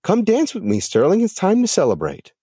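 very high, celebratory invitation; excited calling out. A narrator's reading. Mom calls Sterling to dance, high and excited. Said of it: "his mom yelled".

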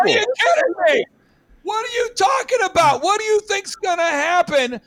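high, loud exasperated yell; incredulous shout. Acted. Speaker yells at Americans ignoring danger, exasperated shout. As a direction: blow up at their complacency. Indignant outburst at complacent people.

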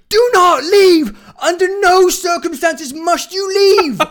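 high, loud, slow panicked yell; screaming warning. Retold or imitated. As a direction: scream at him not to leave. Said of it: "two people screaming at me".

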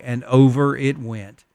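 ordinary delivery

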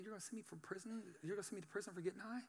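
very soft, meek questioning; retold timid reply, barely voiced; hushed disbelief. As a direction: ask it in a tiny, stunned voice.

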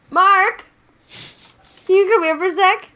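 loud, high call across the house; real call to another room. Real, not acted. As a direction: call him over from the next room. Calling partner from another room.

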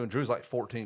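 ordinary talk

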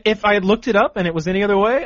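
ordinary talk

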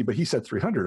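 ordinary talk

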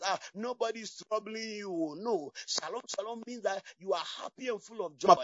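ordinary talk

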